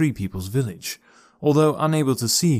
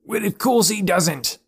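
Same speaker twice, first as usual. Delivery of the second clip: mild yell; 10 semitones higher, slower than usual. A narrator's reading. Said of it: "yelled another of his friends".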